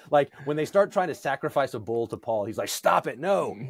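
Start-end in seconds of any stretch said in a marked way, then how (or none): 2.7-3.6 alarmed protest. Retold or imitated. Paul frantically refusing to be worshipped.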